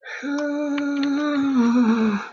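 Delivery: long, drawn-out sigh. Real, not acted. Streamer settling in upstairs, long sigh. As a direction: let out a long real sigh. Catching breath after climbing stairs.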